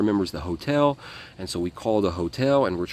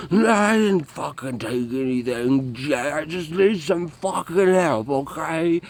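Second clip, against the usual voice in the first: offended; 8 semitones higher, 4 dB louder, slower than usual. Retold or imitated. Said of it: "he gets all offended".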